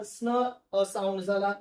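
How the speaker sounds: usual speaking voice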